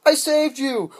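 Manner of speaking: high, loud mock heroine; hammed-up triumphant rescuer; badly faked chick voice; girl rescuer. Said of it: "Girl swoops in".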